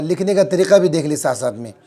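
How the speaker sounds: normal talking voice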